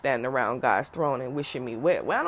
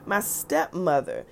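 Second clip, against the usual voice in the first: ordinary delivery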